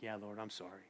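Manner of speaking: very soft, low apology; contrite. Acted. Preacher apologising to the Lord, quiet and contrite. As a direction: admit it humbly, almost under the breath. Replies to God's gentle correction.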